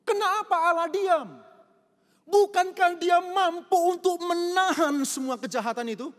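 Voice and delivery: loud, high, impassioned preaching to the church; full-force preaching. Acted. Preacher pleading with the congregation, loud impassioned preaching. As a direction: pour it out like a pulpit climax.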